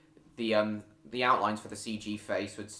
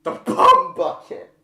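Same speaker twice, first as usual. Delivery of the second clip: much higher, louder, slower squeal; shocked shriek; 12 dB louder, slower than usual. Real, not acted. Streamer reads a rude viewer joke aloud, shocked shriek. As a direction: shriek the words out in disbelief.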